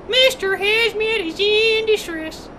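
slow mock-alarm announcement; drawn-out distress call. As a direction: stretch out the distress call slowly. Game alarm, drawn-out repeat.

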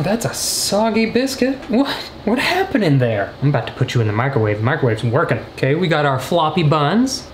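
ordinary talk

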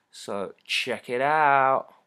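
loud, slow, stretched-out sales plug; playful, drawling call to action; drawn-out plug. Real, not acted. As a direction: stretch it out big for the viewers. Plugs link to viewers.

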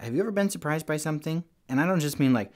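usual speaking voice

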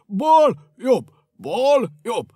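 counting his own steps aloud like soldiers; soldier's marching count. Acted. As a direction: call the steps like a drill sergeant. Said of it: "vezényelni kezdett magának hangosan, mint a katonák".

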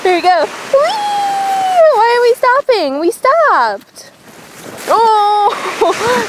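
squealing "whee" high up; sledding downhill. Real, not acted. Riding sled downhill.

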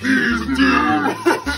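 ordinary talk, nothing marked

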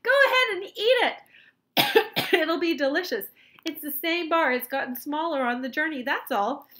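high, loud encouragement, easing down gradually; shouted. A narrator's reading. Wonka telling Charlie the bar is safe, shouted. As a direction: urge him on loudly, starting very high. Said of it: "shouted Mister Wonka".

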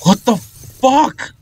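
high, slow, drawn-out shock; startled cry, pitch shooting up; alarmed outcry. Real, not acted. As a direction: stretch each word out in high-pitched alarm. Jump scare in the game.

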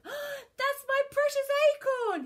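loud, high-pitched exclamation; gasp, then cry out; overjoyed pirate squeal; excited cry. A narrator's reading. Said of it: "he cried".

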